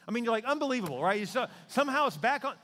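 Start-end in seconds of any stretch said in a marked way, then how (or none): 0.4-1.0 exasperated. Retold or imitated. Listener's exasperation.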